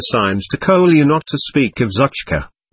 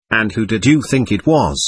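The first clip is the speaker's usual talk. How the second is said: ordinary delivery, nothing marked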